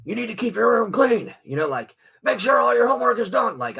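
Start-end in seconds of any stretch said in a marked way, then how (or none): 0.0-1.3 much higher, louder barked order; high, loud mock order from a strict parent; strict military parent. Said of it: "like, militarily". 2.2-3.6 much higher, louder barked order; high, loud mock order from a strict parent; strict military parent. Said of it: "like, militarily".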